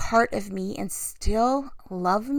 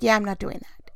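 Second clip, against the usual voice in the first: calm refusal; 3 dB quieter than usual. Retold or imitated. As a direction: retell it evenly and matter-of-factly. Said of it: "he just looked at me so calmly".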